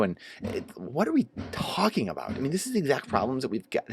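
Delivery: ordinary delivery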